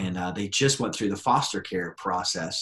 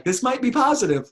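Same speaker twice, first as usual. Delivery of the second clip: much higher, louder; hopeful realization; 13 semitones higher, 6 dB louder than usual. Retold or imitated. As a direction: brighten with sudden hope. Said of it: "I'm like".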